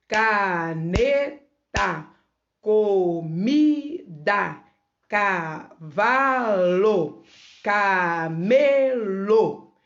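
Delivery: very slow word list, each word in three beats; ca-ne-ta, co-mi-da, ca-va-lo, ca-me-lo, all drawn out; split into syllables. Shown as a demonstration. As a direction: stretch every syllable for young learners. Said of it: "eu abri a boca".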